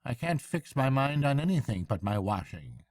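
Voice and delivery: high, hearty barge woman; bright country woman chatting. A narrator's reading. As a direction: barge woman offering a lift.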